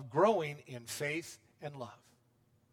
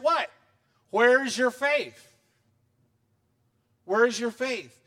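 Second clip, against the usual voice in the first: louder, drawn-out challenge; mock rebuke; 5 semitones higher, 6 dB louder, slower than usual. Jesus rebuking panicked disciples in the storm, loud and slow. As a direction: stretch out a stern rebuke.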